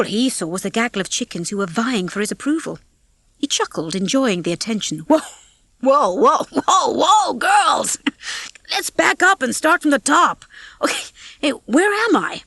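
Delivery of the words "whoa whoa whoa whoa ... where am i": high, amused calming of a crowd; cocky rooster Rocky. A narrator's reading. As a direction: call a halt to the fuss.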